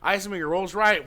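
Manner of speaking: normal talking voice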